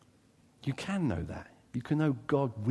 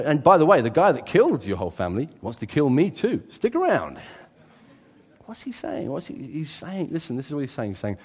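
ordinary delivery

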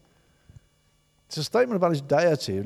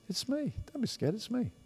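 softer, gentle comfort; tender reassurance; 7 semitones higher, 9 dB quieter than usual. Acted. God coming close to frightened people, softly reassuring. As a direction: soothe a frightened friend.

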